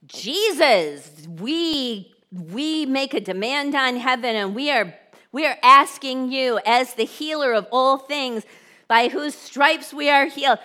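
big, fervent mock prayer; hammed-up declaring prayer; calling heaven down. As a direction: belt out a forceful public prayer. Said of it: "I just called heaven down".

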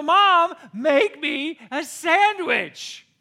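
loud, very slow drawn-out call to mom; drawn-out yell. Retold or imitated. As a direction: stretch the demand out to the kitchen. Repeats demand.

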